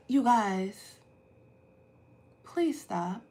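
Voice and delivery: calm sweet voice. Retold or imitated. Mom again asking daughters to stop, soft and calm. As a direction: softly ask them to stop, sweetly. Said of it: "she's like".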